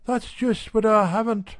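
a hint of a brisk housewife; Mrs Radford's voice. A narrator's reading.

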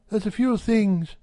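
a hint of a brisk housewife; Mrs Radford's voice. A narrator's reading.

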